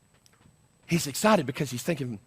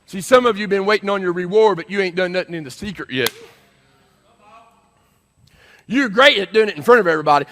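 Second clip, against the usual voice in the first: loud, raised preacher's voice; full-force preaching; 7 semitones higher, 12 dB louder than usual. Real, not acted. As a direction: press it home to the congregation. Preaches at the congregation.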